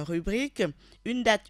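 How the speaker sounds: ordinary talk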